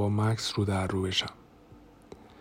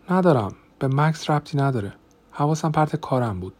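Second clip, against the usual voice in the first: raised pitch, insistent protest; defensive denial; 5 semitones higher, 5 dB louder than usual. A narrator's reading. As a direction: protest it, trying to convince him. Denies problem to her husband. (انکار تدافعی با صدای بالاتر)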